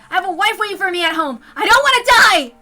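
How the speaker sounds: loud, high, panicked begging; desperate pleading. Acted. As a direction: beg for your life at full volume. Begging gunman for his life.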